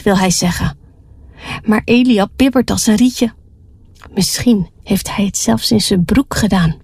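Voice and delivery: plain reading voice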